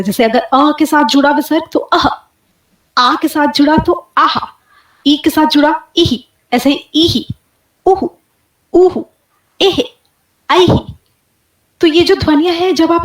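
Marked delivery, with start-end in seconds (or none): 1.7-10.9 अह आह इही उहु with a breathy echo after each vowel; visarga demo. Shown as a demonstration. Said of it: "इसका उच्चारण कुछ इस प्रकार होता है". (विसर्ग की छोड़ती हुई साँस)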